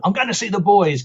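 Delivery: looking forward to it, playfully keen; eager. Retold or imitated. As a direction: wake up thrilled about the day. Eagerly waking to see favourite clients.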